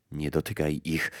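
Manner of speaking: low, soft, grave warning. A narrator's reading. Death warns the mother off the flowers, grave and hushed. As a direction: warn gravely, lowering the voice. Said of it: "zawołała śmierć poważnie".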